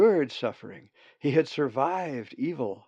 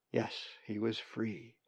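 plain reading voice

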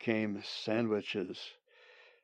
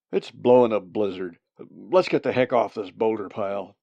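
loud, urgent hunter; calling over wind; 7 dB louder than usual. Acted. Hunter urges retreat in a blizzard, calling out. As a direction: raise your voice against the storm.

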